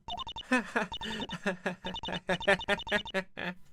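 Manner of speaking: a high, unsettling little laugh; creepy giggle. Acted. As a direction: giggle like you enjoy the question. [name] laughs at murder question.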